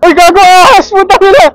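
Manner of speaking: high, loud yelp of alarm; startled shout. Real, not acted. Rider spots a snake on the road, startled shouting. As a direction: cry out in real shock, cursing.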